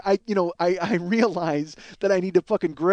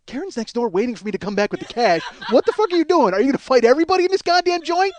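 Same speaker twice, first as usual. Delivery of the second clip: much higher, louder furious rant, fast at end; retold angry yell; 8 semitones higher, 7 dB louder than usual. Comedian yelling at store clerk, furious. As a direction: blow up at the clerk. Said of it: "I'm like".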